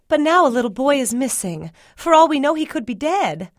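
high, loud, reproachful mama; alarmed mama. A narrator's reading. Mama reproaches Spud over the missing boy, high alarmed voice.

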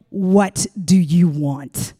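low, slow, cold demand; slow commanding dominatrix voice; hard-ass dominatrix. Retold or imitated. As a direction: make him wait for every word. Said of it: "Diva was a hard ass".

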